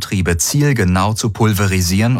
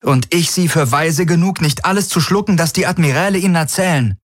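hoch und trotzig; Kirai, trotzig; 10 semitones higher, faster than usual. A narrator's reading. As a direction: schnippisch zurückgeben. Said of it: "trotzige Miene". (Kirai, hohe Stimme, trotzig zu Daraios)

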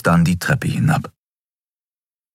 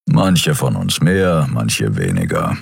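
slow, sinking grumble going much lower; growled; 7 semitones lower, slower than usual. Acted. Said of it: "knurrte Kero".

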